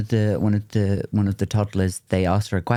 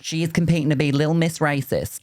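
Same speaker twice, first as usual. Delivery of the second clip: higher, sing-song pageant mom pride; mock pageant mom; 6 semitones higher than usual. Host mocking a pageant mom introducing her daughter. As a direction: announce it like a beaming stage mom. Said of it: "the mums do the bulk of the talking".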